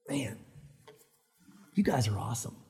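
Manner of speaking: low, near-whispered praise; hushed awe. Real, not acted. Preacher praises the congregation, hushed awe. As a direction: let it out low and breathily.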